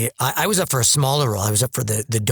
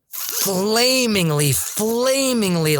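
very slow, swooping, higher-pitched; over-the-top drawl; 5 semitones higher, much slower than usual. Retold or imitated. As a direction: stretch the word out with theatrical relish. Describes an over-the-top stage role.

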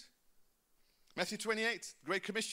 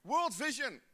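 loud, emphatic preaching; preaching at full force; 3 semitones higher, 7 dB louder, slower than usual. Acted. Pastor urging the church toward world vision, loud. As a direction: drive it home to the crowd.

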